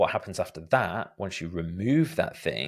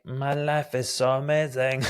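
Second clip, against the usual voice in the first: mock gushing; 5 semitones higher than usual. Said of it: "is like".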